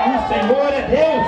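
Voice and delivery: loud slow praise. Retold or imitated. Healed man repeating praise, now loud and slow.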